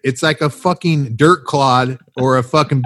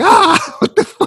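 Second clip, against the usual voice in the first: high, startled yelp into a laugh; mock shriek. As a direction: squeal like glass just hit her. Imagined victim's shriek.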